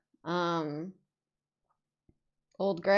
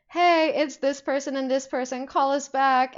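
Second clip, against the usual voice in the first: higher, louder generic caller voice; mock fan voicemail; 5 semitones higher, 6 dB louder than usual. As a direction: imitate an eager caller leaving a message.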